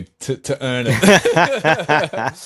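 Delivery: drawn-out words breaking into laughter; real laughter. Real, not acted. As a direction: crack up as you finish the phrase. Breaks into laughter mid-sentence.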